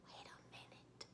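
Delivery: barely audible, awestruck whisper; stunned whisper. Real, not acted. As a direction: whisper as if overwhelmed. Breathless reaction to tender scene.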